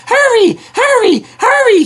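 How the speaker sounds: high, urgent, breathless engine; puffing hard while rushing; panting. A narrator's reading. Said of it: "he panted to the trucks".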